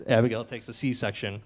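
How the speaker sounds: ordinary talk, nothing marked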